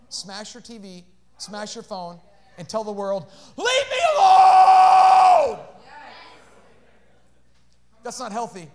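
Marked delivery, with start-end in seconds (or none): 3.6-5.6 slow, higher mock yell; imitated outburst. Preacher acting out an upset person shutting everyone out, mock yelling. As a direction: stretch out a mock cry at the world.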